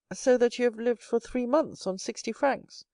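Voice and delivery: usual speaking voice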